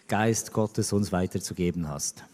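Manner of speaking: ordinary talk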